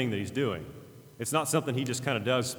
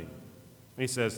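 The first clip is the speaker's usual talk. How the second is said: ordinary talk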